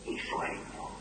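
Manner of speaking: fading whisper. Acted. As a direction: let it die away into breath. Poem fades into sleep.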